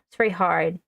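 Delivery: usual speaking voice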